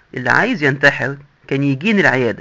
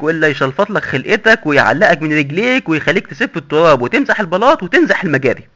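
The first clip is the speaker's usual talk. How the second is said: usual speaking voice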